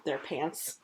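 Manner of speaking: ordinary delivery, nothing marked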